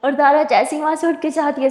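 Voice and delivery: ordinary delivery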